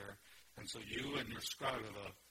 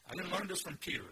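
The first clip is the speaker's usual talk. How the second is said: "I didn't learn this from Peter" higher and forceful; emphatic denial; 7 semitones higher, 5 dB louder than usual. Acted. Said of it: "That's em-, emphasis".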